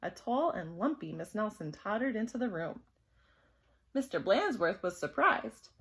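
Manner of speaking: ordinary delivery, nothing marked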